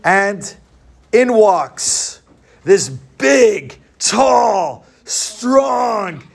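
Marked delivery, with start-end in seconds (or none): none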